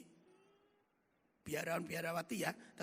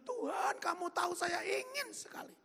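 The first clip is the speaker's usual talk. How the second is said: high, tearful plea to God; 9 semitones higher than usual. Retold or imitated. Weeping alone in his room, pleading with God. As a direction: let the longing break through. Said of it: "menangis sejadi-jadinya".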